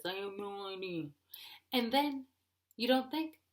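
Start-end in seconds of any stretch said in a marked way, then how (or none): 0.0-1.1 mock husband talking through buck teeth; buck-toothed husband voice. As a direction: talk dopily over big front teeth. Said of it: "you have buck teeth and you talk like this".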